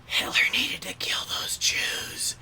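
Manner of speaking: slow, airy whisper; creepy whisper. Shown as a demonstration. Demonstrating that anything whispered sounds creepy, slow whisper. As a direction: hush it like a secret. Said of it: "you can whisper anything".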